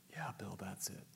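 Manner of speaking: soft unvoiced whisper; a hushed divine whisper answering Bill; whispered voice of God. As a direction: breathe it like a gentle inner voice.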